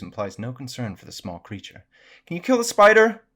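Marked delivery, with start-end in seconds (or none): 2.3-3.2 high, loud hollered request; calling out. A narrator's reading. Child asks Dad downstairs for help, hollering. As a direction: holler the question through the house.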